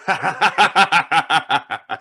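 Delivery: loud, high sinister laugh; mocking echoing cackle; the Shadow's queer laugh; eerie Shadow laugh. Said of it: "He has a queer kind of laugh".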